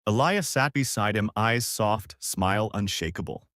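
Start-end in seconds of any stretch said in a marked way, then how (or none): none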